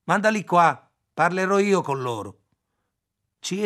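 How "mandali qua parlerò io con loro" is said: loud call from the next room; man calls to a girl in the next room, loud. A narrator's reading. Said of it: "chiamò in bengali dalla camera accanto".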